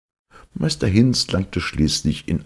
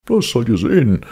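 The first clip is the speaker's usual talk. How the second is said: higher, louder boasting ogre; indignant ogre; 10 semitones higher, 6 dB louder than usual. Acted. Ogre answers the cat's challenge, higher and louder. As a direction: promise it with pride. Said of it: "gab der Oger zurück".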